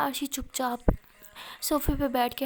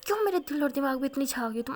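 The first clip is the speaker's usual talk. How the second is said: ordinary delivery, nothing marked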